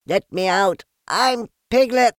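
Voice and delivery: high loud panicked cry. A narrator's reading. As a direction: yell to be let out. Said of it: "cried Piglet".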